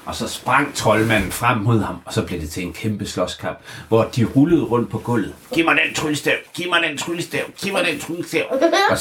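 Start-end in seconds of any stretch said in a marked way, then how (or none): 5.5-8.4 high, insistent wizard voice demanding the wand; high greedy wizard. Acted. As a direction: grab at it, pitched way up. Wizard grabbing fighting for wand.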